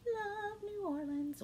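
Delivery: high, slow and drawn out; sing-song gush. Real, not acted. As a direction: gush about it like a tune. Repeats phrase, second drawn out.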